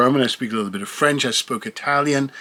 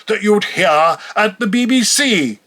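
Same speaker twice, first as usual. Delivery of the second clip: Queen's English; 6 semitones higher than usual. Shown as a demonstration. Recalling the English taught at school, Queen's English. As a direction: put on a BBC announcer's British English.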